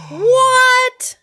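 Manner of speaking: high loud surprised squeal; shocked disbelief; shocked what. Real, not acted. Surprised by album name.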